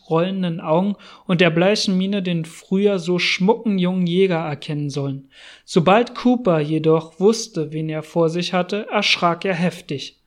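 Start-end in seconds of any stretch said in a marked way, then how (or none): none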